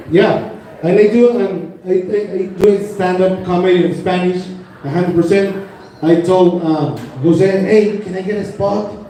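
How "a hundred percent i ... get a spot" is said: normal talking voice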